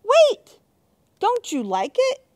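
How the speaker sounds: high, loud excited call; delighted call. Acted. Chris calling after the fleeing monsters, high and delighted. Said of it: "Chris called with delight".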